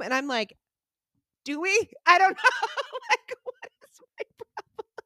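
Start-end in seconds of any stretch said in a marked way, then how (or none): 1.4-4.9 high, giggling fluster; high nervous giggly retelling; flustered laugh. Retold or imitated. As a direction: laugh nervously at your own panic. Flustered answer to customs agent.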